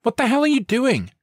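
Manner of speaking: very high, loud outraged demand; a girl's voice in disbelief; shocked yelling. A narrator's reading. Student confronting SWAT breaking the glass, shrill outrage.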